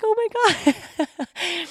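high, drawn-out "oh my God"; exaggerated incredulous reaction, high and slow; mock disbelief. Exasperated reaction to partner's offer.

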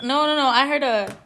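loud, alarmed insistence; real panicky protest; freaking out. Real, not acted. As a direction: cut off the doubters, spooked and loud. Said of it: "you're just freaking out now".

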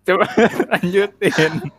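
trying not to laugh, giggling; words breaking into a held-back giggle; stifled giggles. Real, not acted. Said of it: "Lu nahan ketawa pasti".

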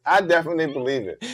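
normal talking voice

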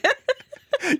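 high-pitched burst of laughter. Real, not acted. Laughs mid-sentence.

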